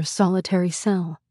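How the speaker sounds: usual speaking voice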